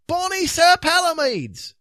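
high, loud Scottish cry; old Scots folk. A narrator's reading. Old ones admiring the knight, high Scottish. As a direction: exclaim in broad Scots. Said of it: "said a number of old ones".

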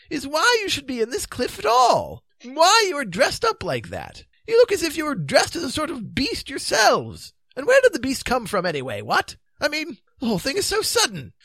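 high-pitched plaintive protest. A narrator's reading. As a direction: complain high, quite at a loss. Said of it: "said the king plaintively".

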